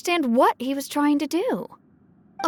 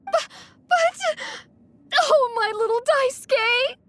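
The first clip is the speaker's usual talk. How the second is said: stammering, then a much higher cry of despair; distraught mother; 9 semitones higher than usual. Acted. Mother crying out for her missing son Daisuke, distraught. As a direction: break down calling for your little boy.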